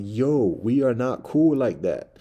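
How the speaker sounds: loud, slow, firm warning. Retold or imitated. Man repeating his loud warning to the mouse. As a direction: lay down the law, slowly and loudly. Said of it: "like, raising my voice".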